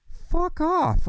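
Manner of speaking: sharp high burst of anger; angry retort. Retold or imitated. Retelling the job call, a high angry retort. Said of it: "I'm like".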